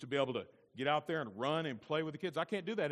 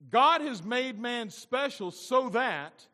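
loud, high, slow preacher's proclamation; full-force preaching; 11 semitones higher, 11 dB louder, slower than usual. Acted. Pastor expounds the creation verse to his congregation, preaching at full force. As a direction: preach it out, drawing out the key words.

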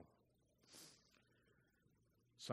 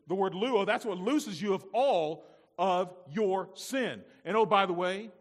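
slow, emphatic, building louder; impassioned preaching; 7 semitones higher, 13 dB louder, slower than usual. Acted. Preacher voicing Jesus telling Peter he must be bathed, impassioned. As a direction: drive each phrase home with mounting force.

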